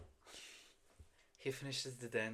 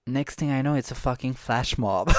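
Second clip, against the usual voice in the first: excited; 17 dB louder than usual. Real, not acted. Recalling the surprise flash mob.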